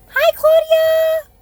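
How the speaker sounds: high, stretched-out greeting; sing-song hello. Real, not acted. Greets viewer on live stream.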